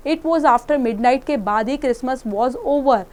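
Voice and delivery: ordinary talk, nothing marked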